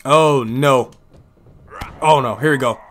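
excited live play-by-play calling; wrestling commentator. Acted. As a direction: call each move as it lands.

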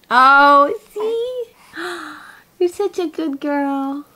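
high cooing praise with a gasp. Real, not acted. Mother praising her baby girl, high gushing coo.

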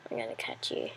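low secretive whisper; sneaky whispered threat to a bat. Acted. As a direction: hunter whispering to the prey. Chasing a bat.

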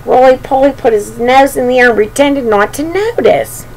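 ordinary delivery

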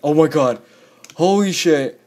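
loud, high burst of disbelief; shocked exclaiming. Real, not acted. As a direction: exclaim after a narrow escape. Narrow escape in the game.

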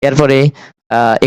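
usual speaking voice

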